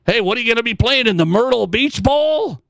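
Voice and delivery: ordinary talk, nothing marked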